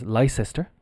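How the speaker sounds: "Leicester" as LIE-sess-ter; common mispronunciation. Shown as a demonstration. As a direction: sound out "Leicester" as written, in three syllables. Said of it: "a lot of people think is".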